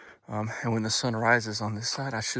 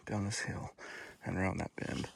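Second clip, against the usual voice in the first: usual speaking voice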